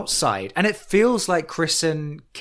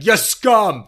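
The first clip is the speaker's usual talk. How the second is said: higher, louder bark; mock tough yell; 7 semitones higher, 6 dB louder than usual. Mocking the show's chest-puffers, louder. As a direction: spit it like a brawler. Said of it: "puffing your chest and going".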